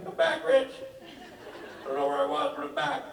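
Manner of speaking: high, lost and helpless mock whine; panicked Rob. Lost friend calling Rich, high helpless whine. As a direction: pitch it up like a lost drunk friend.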